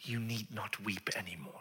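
very soft, whispery consolation; hushed, tender reassurance; gentle comfort. Acted. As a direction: soothe someone who is weeping. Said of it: "She's weeping and he says".

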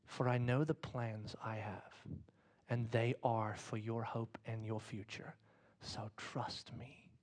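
soft, slow, hushed promise; whisper. Acted. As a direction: lean in and whisper it slowly. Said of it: "be a whisper into your life".

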